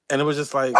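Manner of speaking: ordinary talk, nothing marked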